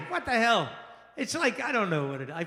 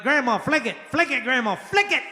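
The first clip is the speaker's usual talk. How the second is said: put-on kids urging; 5 semitones higher, 6 dB louder than usual. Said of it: "We're like".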